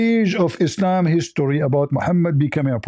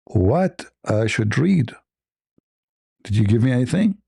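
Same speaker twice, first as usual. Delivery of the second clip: slow puzzled question; 5 semitones lower than usual. Retold or imitated. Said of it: "I'm saying".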